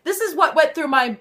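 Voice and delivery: usual speaking voice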